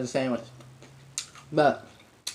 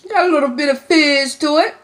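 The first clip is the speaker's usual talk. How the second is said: excited; 8 semitones higher, 5 dB louder than usual. Real, not acted. First taste, fizzy drink.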